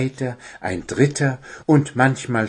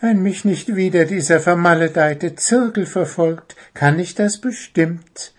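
high little bird voice, smiling; little bird beaming at his teacher; 8 semitones higher, 3 dB louder than usual. A narrator's reading. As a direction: say it with a relieved smile. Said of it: "meinte er lächelnd".

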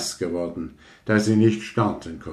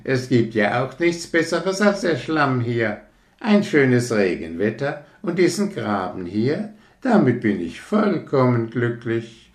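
higher frog voice; cheerful frog; 5 semitones higher than usual. Acted. Frog welcomes rocket to the ditch, cheerful. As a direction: greet the newcomer happily.

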